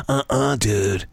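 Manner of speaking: low, slow-witted surfer dude; mock surfer-dude voice; Spicoli. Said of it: "Not the surfer dude Spicoli".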